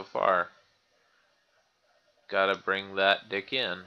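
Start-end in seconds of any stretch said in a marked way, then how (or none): none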